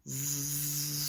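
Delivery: soft buzzing snore; a quiet sleepy zzz; snoring zzz. Acted. Box makes snoring noise.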